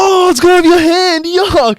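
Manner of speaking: high, loud squeal of mock disgust; mock disgusted onlooker. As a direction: shriek it like a fussy bystander.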